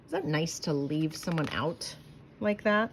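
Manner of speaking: ordinary delivery, nothing marked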